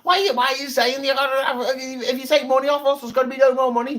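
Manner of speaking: high mocking Scouse accent. Rich people scoffing at wealth tax, Scouse accent. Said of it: "why they've got Scouse accents".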